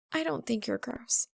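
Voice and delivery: quiet reassurance; quietly. A narrator's reading. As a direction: say it gently to calm him. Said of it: "she says quietly".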